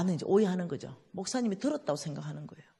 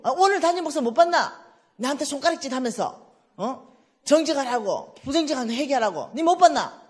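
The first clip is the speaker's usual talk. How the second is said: loud, much higher worked-up friend; agitated phone call; 6 semitones higher, 7 dB louder than usual. Retold or imitated. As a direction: push the words out in alarm.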